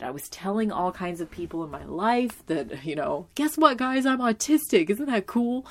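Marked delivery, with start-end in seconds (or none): 3.3-5.7 higher and louder, bursting with news; excited announcement. Retold or imitated. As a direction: blurt it out like big happy news. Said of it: "that I was so excited".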